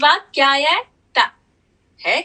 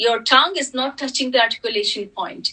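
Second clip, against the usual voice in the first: normal talking voice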